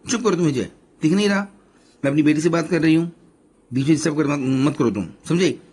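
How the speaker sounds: loud, high, scolding the daughter and husband; angry scolding. A narrator's reading. Mother scolding daughter on the phone, angry and loud. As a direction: snap at them, furious and fast. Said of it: "Shashi ने बड़ी आंख करके विजय को डराते गुस्से से बोला".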